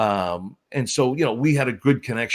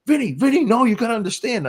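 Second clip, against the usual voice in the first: high, louder whiny plea; pleading Dave; 11 semitones higher, 4 dB louder than usual. Retold or imitated. Young wrestler begging the boss, high and whiny. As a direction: beg like a rattled young wrestler.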